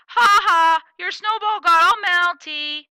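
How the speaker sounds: loud, high, slow mocking sing-song; smirking taunt. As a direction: gloat over her sister's trouble.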